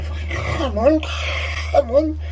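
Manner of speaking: strained high-pitched come on, frustrated; pleading with a stuck shaker, high and strained; straining high. Real, not acted. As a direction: urge the shaker on in a squeaky high voice. Struggling to mix shaker.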